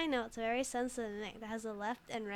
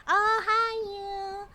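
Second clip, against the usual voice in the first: excited greeting; 9 semitones higher, 10 dB louder, much slower than usual. Real, not acted. Greeting chat with cute burst.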